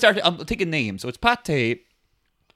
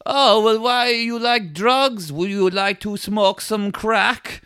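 put-on higher, slow German accent; Hans; 5 semitones higher, slower than usual. German guy offers the gang drugs, drawn-out German accent. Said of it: "He's like".

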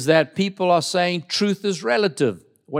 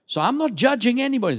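normal talking voice

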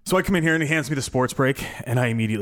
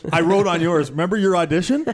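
higher, louder teasing, laughing; teasing boss; 7 semitones higher, 5 dB louder than usual. Retold or imitated. Boss teasing the now-hired host, laughing. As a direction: rib him about the old audition. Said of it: "He goes".

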